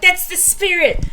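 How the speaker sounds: high, loud, drawn-out whoop of approval; rousing cheer. Acted. Cheering Thunder's battle cry on, high and rousing. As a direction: cheer on a fired-up teammate, slowly and loudly.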